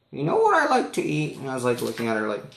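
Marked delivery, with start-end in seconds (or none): 0.1-1.4 put-on high old lady voice; old lady leading into a joke; high-pitched old woman impression.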